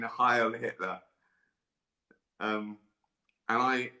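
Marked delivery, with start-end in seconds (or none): none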